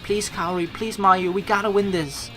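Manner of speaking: ordinary talk, nothing marked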